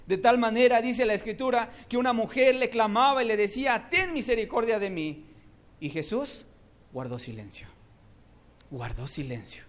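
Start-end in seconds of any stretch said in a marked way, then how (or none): 0.1-5.3 raised, loud preaching of the scripture; fiery preaching. Acted. Preacher telling the woman's cry to the church, in loud preaching. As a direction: lift it high and proclaim it.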